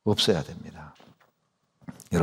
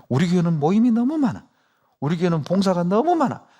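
higher mock grumble about the church; blaming complaint; 6 semitones higher than usual. Preacher imitating members blaming their church, mock grumble. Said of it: "교회 탓한다니까요".

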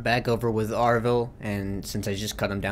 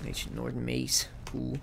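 ordinary delivery

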